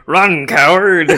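loud, slow booming machine voice; Sinistar robot voice. Retold or imitated. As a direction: boom it like an arcade villain. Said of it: "the fucking Sinistar machine comes on and he's like".

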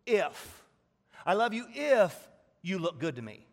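a hammed-up demanding lover; emphatic mock lover. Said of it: "let's sum it up this way".

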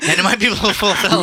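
high, loud theatrical king; Herod. Acted. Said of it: "I wanted to do Herod again".

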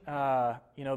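usual speaking voice

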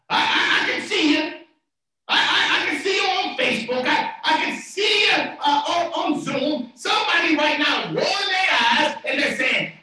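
high, loud, stammering-start preacher call; animated preaching. Real, not acted. Preacher calling out online viewers, high loud preaching. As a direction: tease the doubters at high volume.

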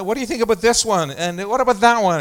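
imitated eager shopper. Shopper asking her partner about items, imitated eagerly. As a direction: mimic an excited shopper pointing things out. Voicing a young woman shopper.

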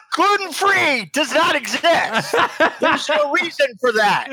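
high, emphatic, laughing declaration; mock outrage. Host objecting to gluten-free noodles, mock outrage.

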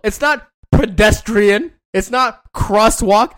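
slow, stressed not pedestrian, not crosswalk; slow lecturing stress on not; emphatic. Shown as a demonstration. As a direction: spell it out with heavy emphasis. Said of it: "lecturing friend on yield".

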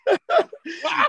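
very high-pitched laughing fit; real laugh. Real, not acted. Comedian laughing over being clowned about a health scare, high laugh. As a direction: squeal with laughter.

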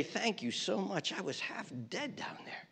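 soft, halting thanks; humble gratitude. Acted. Half-dead man to the guy who saved him, humble gratitude. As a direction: thank your rescuer quietly, stumbling over the words. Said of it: "turn to the guy who saved him and he'd say".

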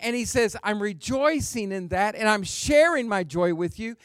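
high, rolling sermon cadence; fervent preaching. Acted. Preacher voicing Paul's care for his church, high and fervent. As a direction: keep the pitch up and pile phrase on phrase.

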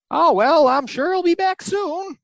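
a kindly monster who waits without worry; cheerful Baxon voice. Retold or imitated. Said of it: "he ends up falling into the pit and is like".

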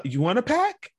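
imitated kid. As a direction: imitate a kid's question, lifting at the end.

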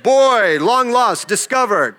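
hammed-up TV news voice; news announcer. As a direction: announce it like a breaking news anchor. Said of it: "I'm on the news like".

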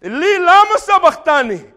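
loud, high anguished cry; Jesus crying out. Acted. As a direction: cry it out to heaven. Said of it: "Jesus cried out".

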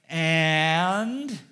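loud, drawn-out "And?" climbing at the end; expectant, stretched-out prompt; interrupting wife. Retold or imitated. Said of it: "my wife interrupted".

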